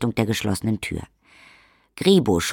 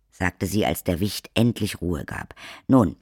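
normal talking voice

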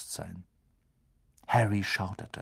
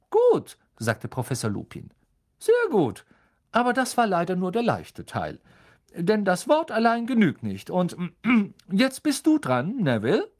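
higher, louder friendly professor voice; kind teacher; 9 semitones higher, 4 dB louder than usual. A narrator's reading. Teacher explaining the spell to the class, higher and friendly. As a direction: explain patiently like a gentle teacher.

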